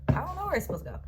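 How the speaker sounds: high, loud and fed up; exasperated outburst. Retold or imitated. Telling her boyfriend she has no idea, exasperated high outburst. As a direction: throw up your hands, I don't know.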